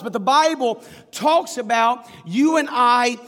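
swelling pulpit emphasis; preaching build. Acted.

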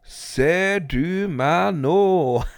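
menacing stranger calling to his mother; sinister figure. A narrator's reading. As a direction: stretch each word like a ghost at the window. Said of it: "sa skikkelsen".

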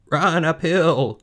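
high, slow, loud sheep call; drawn-out high animal cry. Acted. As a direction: stretch it out like a calling sheep. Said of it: "cried the sheep".

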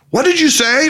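can't believe his ears; astonished. A narrator's reading. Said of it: "replied an astonished Harry".

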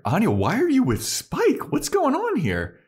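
put-on a jealous boyfriend's rising confusion; confused questioning. Xander's confused questions, retold.

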